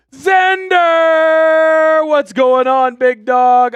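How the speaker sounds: loud, high, long name call; hype shout-out. Real, not acted. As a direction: bellow the name like a stadium announcer. Greeting a viewer on screen.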